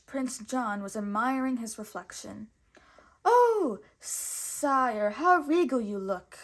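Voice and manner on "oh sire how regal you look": fawning snake. Acted. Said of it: "admired Sir Hiss".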